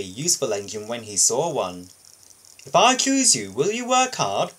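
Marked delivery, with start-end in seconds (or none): 2.7-4.5 higher, louder kindly question; bright questioning boss. Acted. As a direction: test the little engine. Boss questions eager engine.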